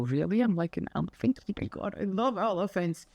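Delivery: an elephant lover taking it as praise; delighted reply. Retold or imitated. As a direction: squeal it like a happy compliment. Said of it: "And I go".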